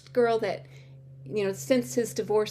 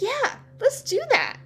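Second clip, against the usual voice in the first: high, louder burst of eager agreement; excited yes, pitched way up; thrilled; 5 dB louder than usual. Retold or imitated. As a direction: act out jumping at the offer. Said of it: "I was thrilled".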